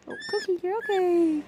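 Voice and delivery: normal talking voice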